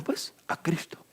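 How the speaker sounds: whispered answer, almost secret. Acted. Preacher whispers the answer to his congregation.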